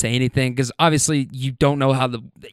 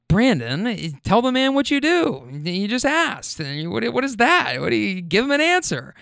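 exasperated wife, pitched up high; annoyed wife; 7 semitones higher than usual. Retold or imitated. Wife scolding her husband for his vague answer, mock annoyed. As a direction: imitate a wife nagging in a higher voice. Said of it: "gets annoyed at that".

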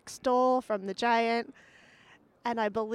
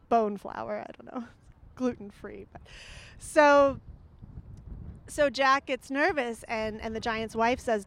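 plain reading voice

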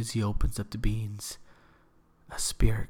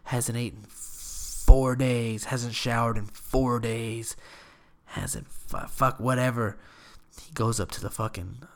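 ordinary delivery

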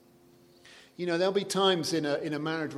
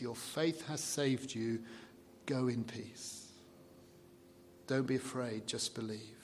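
slow, soft blessing; tender Jesus; 3 semitones lower, 7 dB quieter than usual. Retold or imitated. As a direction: send her off gently, in peace. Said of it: "a sampling of how it feels".

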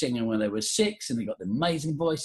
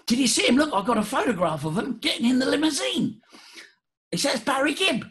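excited workmate; 12 semitones higher than usual. Retold or imitated. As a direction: play the eager factory mate. Workmate shows photo.

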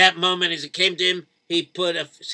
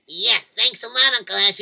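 bright thanks; 6 semitones higher, 8 dB louder than usual. Acted. Kid thanks uncle brightly.

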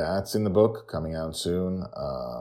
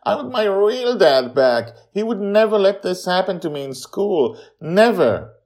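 put-on bitter boy; 8 dB louder than usual. A narrator's reading. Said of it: "no longer crying".

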